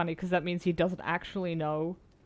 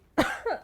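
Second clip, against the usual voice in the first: sharp choking cough; coughing fit; 11 semitones higher than usual. Real, not acted. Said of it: "I'm choking to death".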